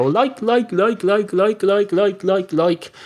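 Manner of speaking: high, droning run of one filler word; mimicked youngsters. Imitating young people with no content to their sentences, high repetitive chant. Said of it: "they go".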